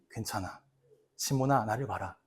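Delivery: very soft, low, gentle comfort; tender reassurance. Retold or imitated. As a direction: soothe him quietly like a father. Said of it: "예수님이". (예수가 시몬을 다정하고 아주 낮게 달램)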